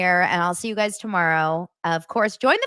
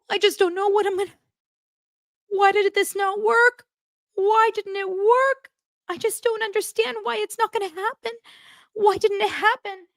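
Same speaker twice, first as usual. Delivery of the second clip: high, whiny mock-crying voice; mock emotional; 13 semitones higher than usual. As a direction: imitate someone falling apart, pitched way up. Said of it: "Why are you getting so emotional?".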